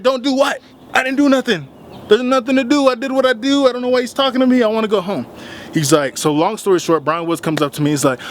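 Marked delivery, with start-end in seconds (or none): 0.0-5.3 high-pitched, indignant outburst; heated protest. Retold or imitated. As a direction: protest your innocence in a high, rushing voice. Acts out protest while friend holds him back.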